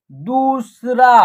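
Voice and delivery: दूसरा said slowly with दू held long; long vowel. Shown as a demonstration. Teacher joining letters for learners, दूसरा drawn out. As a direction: stretch the ऊ in दूसरा. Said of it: "आप long तरीके से उच्चारण".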